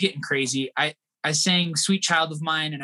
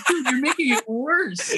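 exasperated; 8 semitones higher, slower than usual. Retold or imitated. Calming panicked driver.

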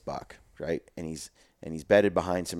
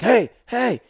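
much higher, louder call of hey; 13 semitones higher, 6 dB louder than usual. Retold or imitated. Desperate hunter calling to a buck about to leave, loud call. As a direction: call out sharply to stop the buck. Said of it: "A lot of guys will be like".

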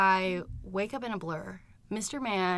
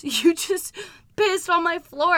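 higher, louder mock outrage; retold accusation; 9 semitones higher, 8 dB louder, slower than usual. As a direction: accuse loudly, imitating an angry boyfriend. Acting out the boyfriend's accusation.

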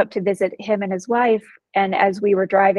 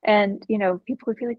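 ordinary delivery

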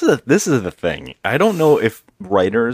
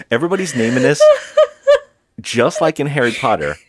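laughing through the words, higher and louder; 6 semitones higher, 6 dB louder, faster than usual. Real, not acted. Host trying to recall a silly movie name, laughing. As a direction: get it out through helpless laughter.

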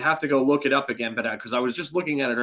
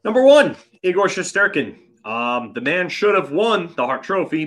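excited; 7 semitones higher, 6 dB louder than usual. Real, not acted. Excited praise of goalie.

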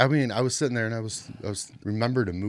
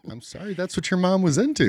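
laughing; 12 semitones higher than usual. Real, not acted. Laughing through the mom joke.

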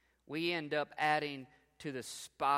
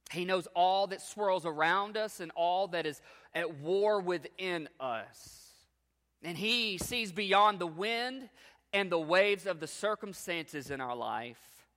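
louder, higher, rising fervour of the pulpit; preaching at full force; 4 semitones higher, 9 dB louder than usual. Acted. Preacher to the congregation about the storms, full-force preaching. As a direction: proclaim it to the whole congregation.

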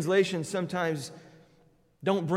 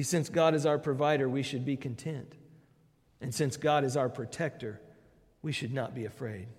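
usual speaking voice

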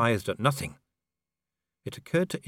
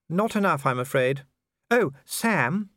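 high, slow genteel lady; a noble lady musing aloud; Lady Sybil; 7 semitones higher, 3 dB louder than usual. A narrator's reading.

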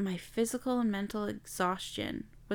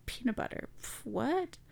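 breathy, soft mock disbelief; half-whispered mock disbelief; incredulous listener; 4 semitones higher, 8 dB quieter than usual. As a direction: scoff at it like a puzzled listener. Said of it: "And you're like".

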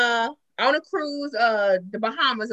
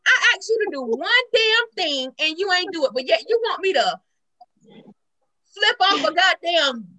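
higher-pitched retold scolding; pissed off; 5 semitones higher than usual. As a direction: scold him, reliving your annoyance. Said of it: "And it pissed me off".

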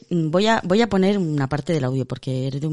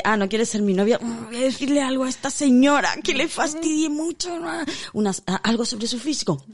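lightly voiced spiteful young man, higher; a hint of a sulky suitor plotting an insult; mock rejected suitor; 7 semitones higher than usual. Imitating the young man who wants to hurt the woman, spiteful. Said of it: "es en plan de".

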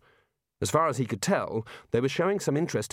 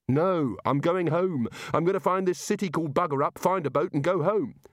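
higher, insistent resolve; determined; 6 semitones higher than usual. Acted. Coward insisting he is going home, determined. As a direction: dig in and announce your plan. Rincewind refuses to stay.

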